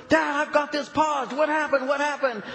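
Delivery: loud, high, urgent questions; excited shouting down the phone; frantic son. Retold or imitated. Son frantic over paused game.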